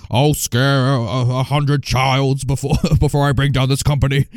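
slow, drawn-out villain impression; Mister Waternoose. Host quotes the spider boss's film line, villain impression. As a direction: play the animated spider boss, dragging the words. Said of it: "what does he say in Monsters".

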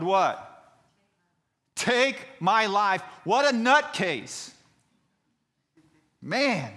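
high, drawn-out shouting; dramatic pulpit outburst, words stretched; full-force preaching. Real, not acted. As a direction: shout it in exasperated disbelief. Preaches Jonah's outburst with comment.

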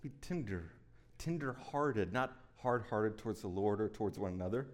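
soft, gentle scripture reading; tender reading. Acted. Preacher reading verse thirty-two softly to the church. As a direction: read it quietly, like a blessing.

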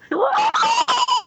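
high shrill squawking laugh. Shown as a demonstration. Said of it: "goes full pterodactyl and just, like, squawks".

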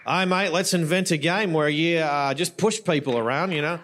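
badly faked Aussie accent; amateur stab at an Australian accent. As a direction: put on a hammy Aussie mate-to-mate voice. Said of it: "Is that a pretty bad accent".

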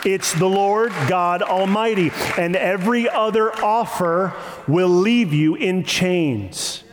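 usual speaking voice

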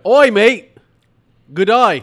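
loud, hammed-up Australian accent; Aussie bunny greeting, brash and loud. Host playing Easter Bunny to co-hosts, in a loud Australian accent. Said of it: "You were Australian".

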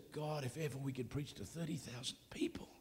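soft breathy whisper; whispered prayer. Retold or imitated. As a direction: whisper it like a longing wish. Wistful prayer among friends.

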